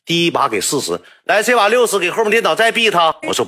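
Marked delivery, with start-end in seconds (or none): 1.2-3.1 high, louder pushy boss voice; mock boss urging. Boss at the internet cafe egging him on, high and pushy. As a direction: egg him on with a higher, louder voice.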